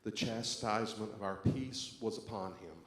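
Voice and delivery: loud, solemn scripture reading for the whole room; responsive reading. Acted. Pastor leading the congregation in the responsive reading, loud and solemn.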